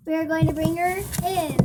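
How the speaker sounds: ordinary delivery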